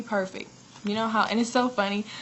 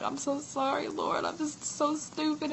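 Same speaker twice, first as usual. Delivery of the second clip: higher and slow, a touch of self-pity; mock remorseful plea; 8 semitones higher, 3 dB quieter than usual. She repeats her plea to the Lord, mildly pitiful and high. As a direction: imitate her younger self apologizing, slowly. Retold repenting in a dream.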